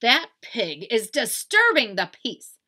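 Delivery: loud, slow indignation; Eugenia Lincoln. Acted. Indignant sister complaining.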